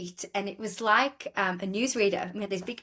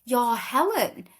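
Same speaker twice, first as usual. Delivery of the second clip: Polish lady surprised; 6 semitones higher, slower than usual. Retold or imitated. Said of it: "she was like".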